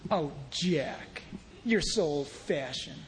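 soft, low teasing complaint; mock objector. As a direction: murmur it as a coy put-down. Voices a listener scoffing at him.

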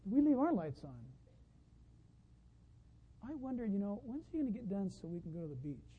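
very soft, wandering musing; distracted inner thoughts. Churchgoer's mind drifting during the sermon, hushed and absent. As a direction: voice a listener's private worries under the breath. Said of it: "You may have been saying".

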